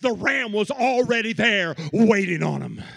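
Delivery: loud rising sermon cadence; preaching at full force. Real, not acted. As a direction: preach it out, building.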